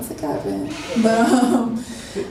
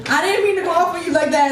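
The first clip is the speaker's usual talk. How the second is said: higher, mildly plaintive apology; retold tearful apology; 6 semitones higher than usual. Worker apologizing to the customer, mock tearful. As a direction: imitate apologizing through tears, lightly. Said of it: "And I was crying".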